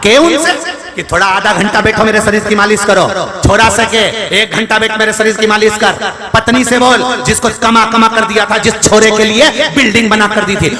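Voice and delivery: high-pitched, driving sermon tirade; full-force preaching. Real, not acted. Preacher berating listeners for a life of hoarding, full-force preaching. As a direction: hammer the repeated words, building in pitch.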